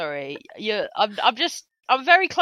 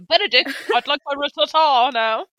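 posh diner; 4 semitones higher, 7 dB louder than usual. Pretend. Posh order to butler.

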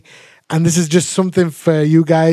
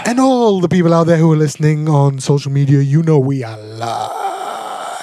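radio voice. Acted. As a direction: draw out the ending like a live presenter. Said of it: "Let me try".

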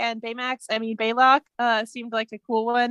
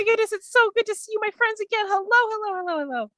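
high, gushing reunion voice; happy puppy excitement; 11 semitones higher than usual. Acted. Hava sees her friends again, like an overjoyed puppy. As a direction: bubble over with hellos, tumbling down at the end. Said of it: "She does the happy, you know, happy puppy".

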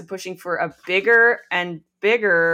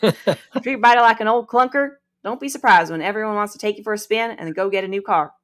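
Southern accent; 3 semitones higher, 4 dB louder, faster than usual. Shown as a demonstration. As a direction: put on a Southern twang. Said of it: "I just want to say that in a Southern accent".

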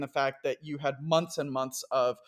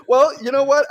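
laughing; 8 semitones higher, 11 dB louder than usual. Real, not acted. Laughing banter.

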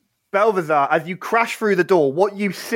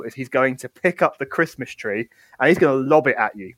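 ordinary delivery

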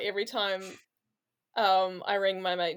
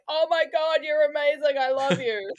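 higher-pitched cooing over a pet; adoring gushing; 6 semitones higher, 4 dB louder than usual. Retold or imitated. Plumber to the cat climbing on her back, adoring gushing. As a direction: gush at an animal you've fallen for.

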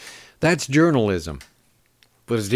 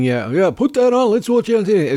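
pitched up, gleeful mock voice; mock eager viewer; 9 semitones higher than usual. Host mocks an imagined ghoulish viewer, gleeful and high. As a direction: play the ghoul who can't wait to watch. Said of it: "it's not like I'm saying".

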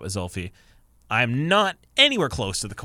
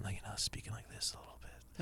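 near-whispered, very quiet murmur; softer tone; 19 dB quieter than usual. Shown as a demonstration. Showing friends how quietly he spoke on the phone, softer tone. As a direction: drop right down to a hushed phone voice. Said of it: "softer tone".

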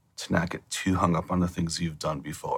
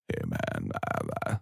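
much lower, softer stoner voice; 14 semitones lower, 8 dB quieter, slower than usual. Retold or imitated. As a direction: talk like a spaced-out stoner, low and quiet. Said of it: "you know the stoner voice where I'm like".

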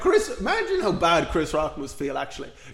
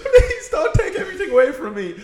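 high, whiny, laughing plea; mock pleading whimper; 6 dB louder than usual. Friend mocks Fallon begging not to lose everything, high whiny plea. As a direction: beg pathetically, half laughing.

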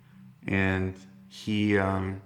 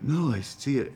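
higher, insistent imitation of brother; brother's old voice; 7 semitones higher than usual. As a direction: protest in a raised kid brother voice. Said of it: "old voice, his old voice".

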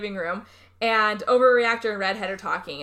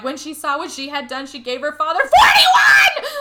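screamed rhyme; 5 semitones higher, 10 dB louder than usual. Retold or imitated. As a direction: chant building to a high, full-volume scream. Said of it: "it just screams it".

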